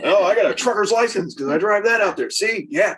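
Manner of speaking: high, fast smug boomer bragging; boomer man voice. Retold or imitated. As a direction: brag like a cocky old boomer. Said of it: "very boomer man there".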